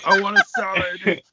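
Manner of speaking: high, loud squeaky joke voice; mock high voice. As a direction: squeak it out to tease. Mocking the taco line.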